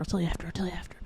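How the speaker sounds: soft secretive whisper. Real, not acted. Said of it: "whispered tease".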